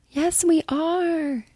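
high, slow, dreamy sing-song; mock women's chorus. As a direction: play the brainwashed women answering in unison. Women answering the cult leader.

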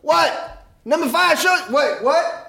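high, startled emcee shouts breaking off; holler, get cut short, then announce; interrupted hype man. Retold or imitated. Emcee called to from off stage, thrown off. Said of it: "And he goes".

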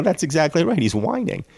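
ordinary delivery

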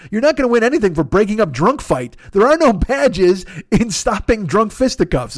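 usual speaking voice

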